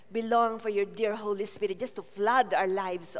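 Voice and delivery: ordinary delivery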